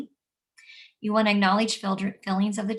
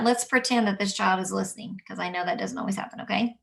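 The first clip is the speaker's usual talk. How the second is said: normal talking voice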